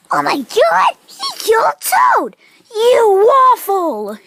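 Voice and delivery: loud, high mock wail; hammed-up horror. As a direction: wail in exaggerated outrage at a killing. Toad has been killed.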